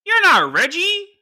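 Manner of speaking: put-on high, loud, slow old lady accusation; the grandmother stops, stares at him and accuses; grandma's shocked line. Old lady voicing grandma in shock. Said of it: "looks me dead in my face and goes".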